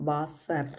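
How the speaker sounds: വാസർ with v and a stressed final er; German pronunciation. Shown as a demonstration. Teacher demonstrating the final r for students. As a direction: lean on the er of വാസർ. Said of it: "stress ചെയ്ത് തന്നെ പറയണം എർ".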